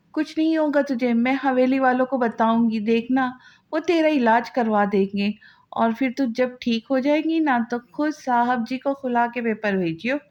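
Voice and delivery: ordinary delivery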